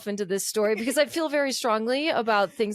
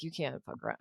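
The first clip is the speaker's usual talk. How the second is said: low, quiet warning; low serious; 7 semitones lower, 12 dB quieter, faster than usual. Retold or imitated. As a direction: mutter it gravely. Friend quoting Doug.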